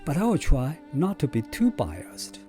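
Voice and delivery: ordinary talk, nothing marked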